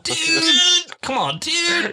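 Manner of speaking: very high, drawn-out pleading; whiny mock exasperation. Joining friend's bit about bad luck, high mock pleading. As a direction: whine it way up high. Mock complaint, things not going their way.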